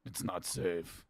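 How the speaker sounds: low, slow ominous warning; ominous local. Retold or imitated. Local at the bar warning a drunk tourist, low slow ominous voice. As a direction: lean in and warn like a grim local. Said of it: "a local leans in and goes".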